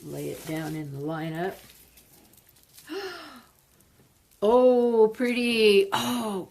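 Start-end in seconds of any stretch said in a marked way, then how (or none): 2.9-3.4 gasp. Real, not acted. Unboxing plants, gasp of delight. 4.4-6.5 delighted. Real, not acted. Delighted unboxing reaction.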